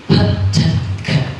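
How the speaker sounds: "p, t, k" each released with a strong puff of air; isolated "p, t, k" sounds with audible aspiration; aspirated consonants. Shown as a demonstration. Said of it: "you can hear the aspiration".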